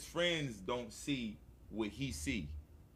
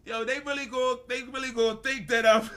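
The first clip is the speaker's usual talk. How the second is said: high, loud retold protest on the edge of laughter; amused alarm; 8 semitones higher, 8 dB louder than usual. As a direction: protest loudly, about to crack up. Retold worry, breaking into laughter.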